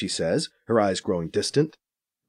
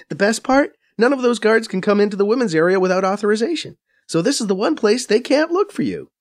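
higher, brisk Faiza voice, a bit scornful; Faiza explaining; 9 semitones higher than usual. A narrator's reading. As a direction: narrator playing Faiza, quick and knowing. Faiza gloating.

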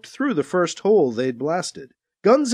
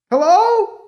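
high, loud call; 13 semitones higher, 6 dB louder than usual. A narrator's reading. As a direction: call again across the hall. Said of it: "the voice called out again".

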